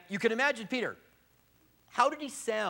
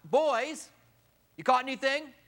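raised, high call from the shore; 8 semitones higher, 5 dB louder than usual. Acted. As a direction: call out across the water. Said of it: "a guy from the shore yells out".

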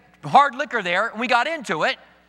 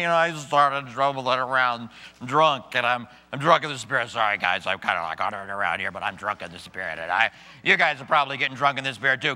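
put-on low, slurred, stumbling drunk voice; hammed-up tipsy preacher; drunk in the Spirit; 9 semitones lower than usual. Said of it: "And so then they would say".

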